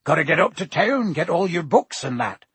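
loud, busy giant making plans; loudly. A narrator's reading. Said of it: "said Hagrid loudly".

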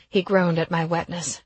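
plain reading voice

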